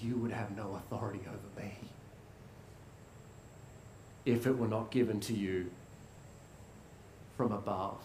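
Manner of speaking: low, hushed, broken-bodied defiance; quiet courage, very soft and low; beaten Jesus. Acted. Jesus, beaten nearly to death, answering Pilate in a low hush.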